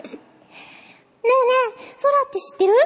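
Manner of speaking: put-on high cute anime girl. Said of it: "アニメ声ね". (高くかわいいアニメ声)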